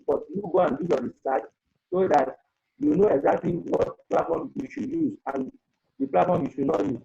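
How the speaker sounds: normal talking voice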